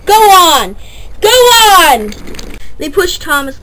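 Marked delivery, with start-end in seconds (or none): 0.0-2.1 shout. Acted. Freight cars pushing Thomas downhill.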